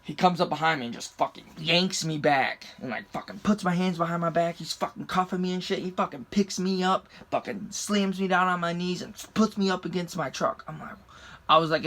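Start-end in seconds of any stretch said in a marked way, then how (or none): none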